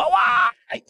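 very high mock cry of pain; retold yelp of pain. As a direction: cry out high, as the wounded king. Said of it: "הוא התחיל לצעוק". (צעקת כאב מוגזמת, בחיקוי)

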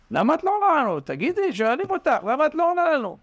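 high, insistent asking; pressing question. Acted. Frogs pressing the climber for her secret, high and insistent. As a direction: demand the secret eagerly.

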